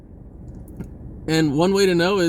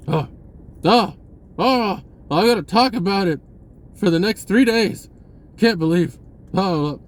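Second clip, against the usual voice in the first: mock outrage; 4 semitones higher than usual. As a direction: play someone who can't let it go. Mocking someone obsessed with gossip.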